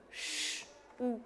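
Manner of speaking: "Ch" as a long hush, then "Ou" on its own; sounds separated. Shown as a demonstration. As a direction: hold the "Ch", pause, then say "Ou". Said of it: "Non, écoute".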